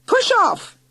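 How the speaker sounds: very high, loud shout. Acted. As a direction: yell them off the doorstep. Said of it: "Aunt Doris shouted through the letterbox".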